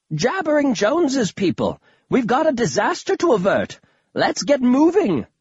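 rally the troops as a dotty grandpa; excited old grandpa. Acted. Voices eccentric grandfather.

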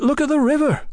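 high-pitched, wide-eyed exclamation; innocent cry. A narrator's reading. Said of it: "Tom cried innocently".

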